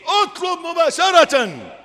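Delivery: slow forceful preaching command; emphatic command. Acted. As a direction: hammer the instruction home.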